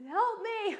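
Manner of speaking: hammed-up plea to the spouse; mock cry for help.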